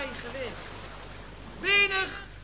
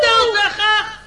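call for silence; 2 semitones higher, 8 dB louder than usual. Acted. The speaker calling the noisy crowd to silence, loudly.

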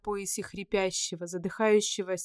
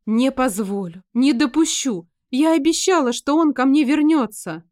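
desperate shout; 4 semitones higher, 8 dB louder than usual. Acted. Fairy cries out in fury.

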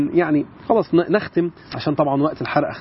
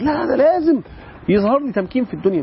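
higher, mock-insistent voice of those who demand victory; raised, mocking insistence; insistent people; 9 semitones higher than usual. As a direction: imitate someone demanding proof of success. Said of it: "بعض الناس النهاردة بيقول لك".

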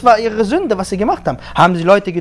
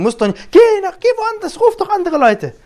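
high, mocking singsong brush-off; pitched-up mock of a dismissive brush-off; mocking whiny voice; 13 semitones higher than usual. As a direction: mimic him high and dismissive. Mocking the boy's words.

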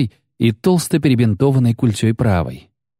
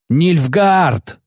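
high shaky cry; 7 semitones higher than usual. A narrator's reading. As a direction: shout the warning, voice cracking high. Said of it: "снова крикнул солдат высоким дрожащим голосом".

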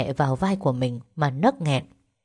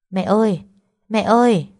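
louder, higher calls for mother; raised-voice worried calling; 5 semitones higher, 5 dB louder than usual. A narrator's reading. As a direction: call through the door, more urgent each time. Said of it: "gọi mẹ".

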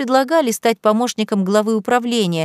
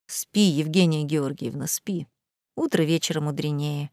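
soft, low bedtime murmur; pillow talk; 4 semitones lower, 6 dB quieter than usual. A narrator's reading. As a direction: lull her gently to sleep. Soothing wife at bedtime.

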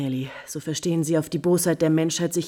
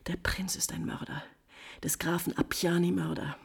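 hushed, grim whisper; secretive whisper; 6 dB quieter than usual. Acted. Countess repeats accusation secretly.

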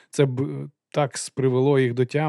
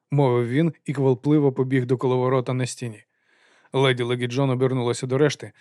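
ordinary delivery, nothing marked

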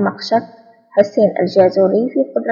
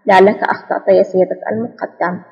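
ordinary delivery

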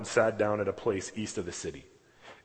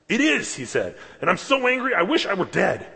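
much higher, louder wail of anger; angry outburst; 10 semitones higher, 6 dB louder than usual. Acted. Jonah telling God he is angry enough to die, loud. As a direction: spit it out, furious and despairing.

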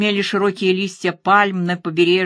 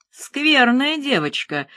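higher, slow reproachful scolding; с укоризной; 6 semitones higher, slower than usual. A narrator's reading. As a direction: chide slowly, as if to a naughty child. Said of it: "с укоризной сказал убийца". (укоризненно, нараспев)

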